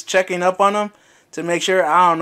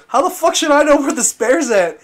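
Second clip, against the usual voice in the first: exasperated outburst; 8 semitones higher, 4 dB louder than usual. Retold or imitated. Boss quoted, exasperated.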